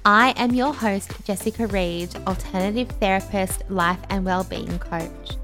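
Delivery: polished upbeat show intro; podcast intro voice. Acted. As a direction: read it like a podcast opener. Podcast intro over music.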